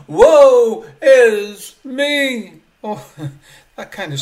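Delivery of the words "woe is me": loud, drawn-out cry of woe. Retold or imitated. Preacher voicing Isaiah before the living God, crying out. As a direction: wail it out slowly, higher and at full volume. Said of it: "he cried out".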